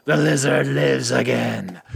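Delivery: hammed-up villain cry held back in volume; mock monster scream. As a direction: draw it out like a comic-book monster. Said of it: "and he screams".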